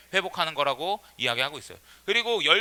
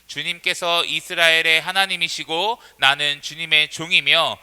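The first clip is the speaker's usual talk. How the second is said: slow, measured congregational reading; scripture reading aloud; 2 semitones lower, 4 dB louder, slower than usual. Real, not acted. Preacher leading everyone in Elijah's prayer, measured scripture reading. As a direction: read out steadily for all to follow.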